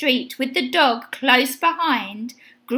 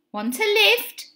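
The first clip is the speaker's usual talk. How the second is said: high-pitched offer, only lightly called; calling out; 8 semitones higher than usual. A narrator's reading. Van driver offers Benny a lift, light high call. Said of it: "called the driver".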